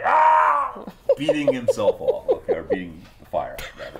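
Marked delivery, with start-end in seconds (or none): 0.1-0.8 high, loud burning yelp; hammed-up scream of panic; mock scream. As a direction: yell "Ah!" like someone suddenly on fire. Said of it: "He's like".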